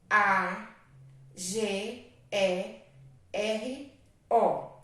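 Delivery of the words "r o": ordinary talk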